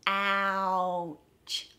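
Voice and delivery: "Ouch" stretched out, gliding through the vowel; slowed down. Shown as a demonstration. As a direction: draw out the vowel of "Ouch" before the ch. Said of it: "practice slowing it down".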